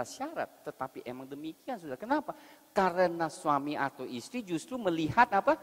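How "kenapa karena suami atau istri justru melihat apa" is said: preaching at full force. Acted. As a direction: hammer the point home from the pulpit.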